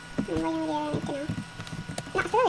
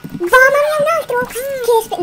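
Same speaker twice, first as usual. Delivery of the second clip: panicked shout; 8 semitones higher, 12 dB louder, much slower than usual. Real, not acted. Ambushed by another mob.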